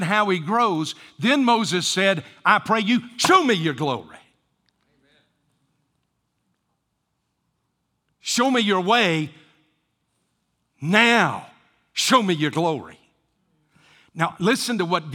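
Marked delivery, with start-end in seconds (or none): 2.4-13.0 louder, pleading cry; fervent plea. Acted. Preacher voicing Moses begging God, loud fervent plea. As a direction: cry it out like a desperate prayer.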